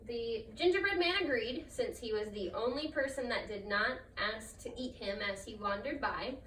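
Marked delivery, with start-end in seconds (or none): none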